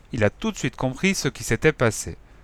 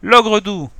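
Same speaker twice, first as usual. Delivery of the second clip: high, loud shout of hope; children crying out together in relief; joyful cry; 9 semitones higher, 7 dB louder than usual. A narrator's reading. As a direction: call out the name, much higher and louder. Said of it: "en chœur nous nous sommes écriés".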